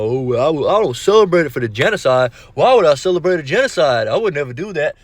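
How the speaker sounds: lightly put-on mock voice, rising on the middle line; a hint of a mocking impression; mocking borrowed voice. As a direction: mimic how they sound when they talk. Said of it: "I'm using, uh, that voice that".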